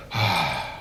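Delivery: long audible sigh; big breath. Acted. Photographer coaches winded bride to breathe, big relieving sigh. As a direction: act out a deep breath let go.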